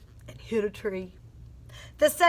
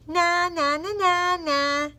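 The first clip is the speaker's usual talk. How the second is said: high sing-song "na na" teasing; snorting bird taunt; 7 semitones higher, 4 dB louder than usual. A narrator's reading. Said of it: "snorted the flugel bird".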